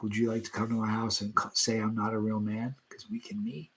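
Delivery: low, quiet mock menace; mock threat. Man answering a commenter who doubts his manhood, quietly threatening in jest. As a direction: issue a soft-spoken challenge, dropping away at the end.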